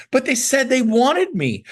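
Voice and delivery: high-pitched whiny protest; mock wounded objection; protesting excuse. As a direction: plead your excuse, pitch climbing high. Voicing an agent's whiny excuse.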